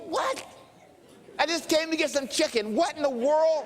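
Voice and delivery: high, baffled exclamation; confused. Retold or imitated. Preacher baffled by a stranger while getting chicken, high confused protest. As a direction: squeak out bewildered disbelief, hammed-up. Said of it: "And I'm just confused".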